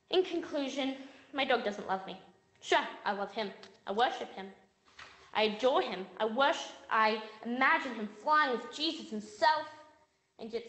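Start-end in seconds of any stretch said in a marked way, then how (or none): none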